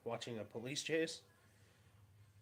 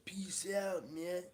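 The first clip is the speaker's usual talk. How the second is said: higher laid-back stoner sign-off; hippie voice; 7 semitones higher, 3 dB louder, much slower than usual. Pretend. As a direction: drawl it like a mellow hippie. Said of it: "Do I need to do the hippie voice?".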